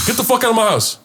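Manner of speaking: high, forceful order to leave; retold angry eviction. Host kicking guest out of the party, angry. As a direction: throw him out, indignant.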